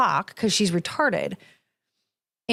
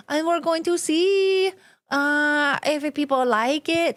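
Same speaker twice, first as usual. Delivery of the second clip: high, slow mock Spanish accent; fake Spanish accent; 5 semitones higher, slower than usual. Mocking the wife's put-on Spanish accent, high and slow. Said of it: "That little accent".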